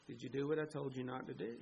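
low, soft and quick; quiet questioning. Acted. God questioning Adam after the fall, quiet questioning.

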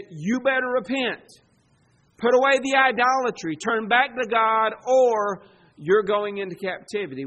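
loud, urgent call to repent; prophet's warning. Acted. Prophet warns the people to repent, loud warning. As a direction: preach it out as a stern warning.

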